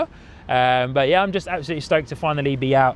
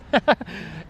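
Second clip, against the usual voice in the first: airy laugh; 11 semitones higher than usual. Real, not acted. Laughing about Amazon access.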